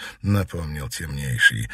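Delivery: ordinary delivery, nothing marked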